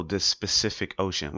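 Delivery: Pacific said as "spuh-SIF-ik"; common mispronunciation. Retold or imitated. Imitating people who confuse the words, mistaken pronunciation. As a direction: put an S before Pacific, making it specific. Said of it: "people constantly say".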